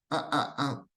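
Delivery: normal talking voice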